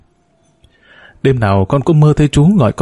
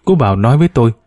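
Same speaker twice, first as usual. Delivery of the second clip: ordinary delivery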